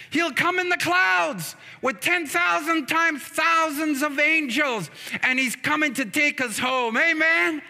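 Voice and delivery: impassioned preaching. Real, not acted. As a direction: preach it with rising fervour. Preaches at full force.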